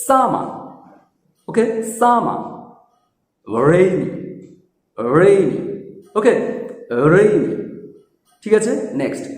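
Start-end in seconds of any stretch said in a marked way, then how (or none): 0.1-2.4 higher-pitched সামা, no final r; British r-less সামা; correct pronunciation. Shown as a demonstration. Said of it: "সঠিক উচ্চারণগুলো দেখো". 3.7-5.6 রেইনি with curled English r; careful model of রেইনি; correct pronunciation. Shown as a demonstration. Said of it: "সঠিক উচ্চারণগুলো দেখো". 7.1-7.5 রেইনি with curled English r; careful model of রেইনি; correct pronunciation. Shown as a demonstration. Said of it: "সঠিক উচ্চারণগুলো দেখো".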